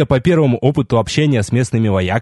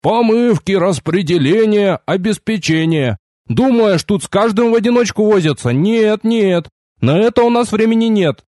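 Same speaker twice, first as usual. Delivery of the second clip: high-pitched, animated explaining; busy quartermaster; 8 semitones higher than usual. A narrator's reading. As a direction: rattle off the procedure in a raised pitch. Quartermaster explains procedure to recruit. (оживлённый голос снабженца высоким тоном)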